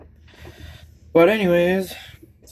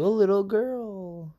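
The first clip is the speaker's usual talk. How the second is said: light falsetto, higher pitch; 9 semitones higher, slower than usual. Shown as a demonstration. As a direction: keep the thin high voice going. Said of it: "trying to talk like that".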